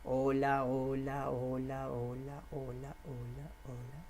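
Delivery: repeated hola dwindling to a hush; fading hola. Shown as a demonstration. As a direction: let the hola fade away inward. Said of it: "cómo se dice hola en la cabeza".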